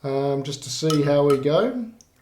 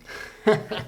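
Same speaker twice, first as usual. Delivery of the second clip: breathy laugh; 7 semitones higher, 3 dB quieter than usual. Real, not acted. Laughs at own joke.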